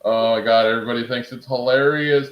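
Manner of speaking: ordinary talk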